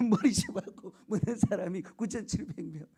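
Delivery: ordinary talk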